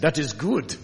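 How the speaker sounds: imitated German accent, firmly stated. Preacher quoting his friend Sven, in a German accent. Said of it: "in his German accent".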